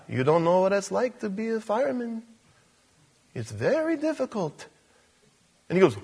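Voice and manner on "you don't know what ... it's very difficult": soft cartoon wizard warning; softly voiced wise cartoon mentor; Mister Wizard. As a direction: caution gently in a cartoon character voice. Voicing Mister Wizard the cartoon lizard.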